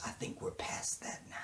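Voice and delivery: retold line in a whisper; hushed reenactment of a TV scene. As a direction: breathe it like a secret.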